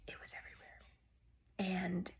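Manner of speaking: hushed, breathy aside; whisper. Real, not acted. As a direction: drop to a whisper for the mess.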